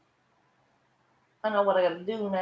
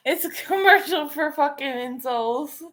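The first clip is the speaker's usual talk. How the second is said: ordinary talk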